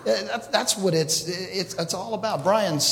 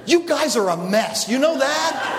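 laughing tease; 8 semitones higher, 3 dB louder than usual. Real, not acted. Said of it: "laughing at congregation".

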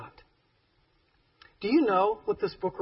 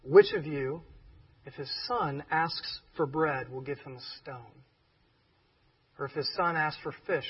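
scripture reading; 3 semitones lower than usual. Acted. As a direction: read the verse gently and evenly. Reads scripture aloud softly.

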